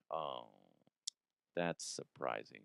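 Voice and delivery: deadpan sarcasm. Real, not acted. Host mocking the sheriff's report with deadpan sarcasm. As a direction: say it dead flat, mocking.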